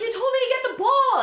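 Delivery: put-on very high, loud kid's yell; protesting child. Confused girl protests to grandfather, high indignant yell. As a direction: holler back like an upset child. Upset child yells back.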